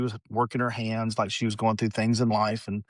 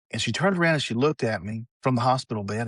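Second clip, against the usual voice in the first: usual speaking voice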